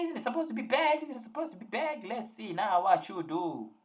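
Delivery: high-pitched taunting quote; high mimicking jeer; mock taunt. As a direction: tease in a high voice, drawing out the end. Acts out a mocking quote.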